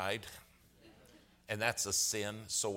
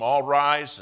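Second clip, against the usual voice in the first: very loud, high bailiff's announcement; courtroom call; 5 semitones higher, 16 dB louder than usual. Retold or imitated. Bailiff opening court at eight thirty, booming call. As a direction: call the whole courtroom to its feet.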